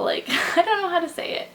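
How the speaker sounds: high, quick giggling fluster; giggly and flustered; flustered laugh. Real, not acted. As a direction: laugh it off, embarrassed. Flustered giggle describing her.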